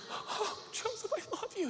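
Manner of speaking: high, soft, crying declaration; tearful love. Acted. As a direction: squeak it out through happy tears. Mary tearfully reconciles with Joe.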